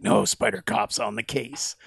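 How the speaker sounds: slow, put-on cop narrator voice; Spider Cop. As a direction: play a self-styled cop hero, drawn out. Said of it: "he starts narrating it as Spider Cop".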